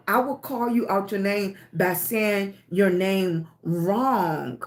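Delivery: ordinary talk, nothing marked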